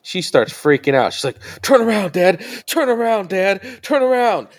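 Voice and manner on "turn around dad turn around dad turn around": high, pleading, mock-tearful turn around; panicked crying daughter. Dad imitating his crying daughter begging to turn around, high and pleading. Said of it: "And she's crying and everything".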